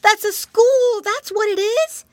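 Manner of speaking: high, loud piglet; excited little pig voice. Acted.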